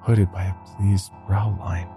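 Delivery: usual speaking voice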